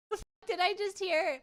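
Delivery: ordinary delivery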